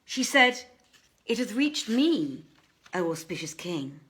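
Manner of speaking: plain reading voice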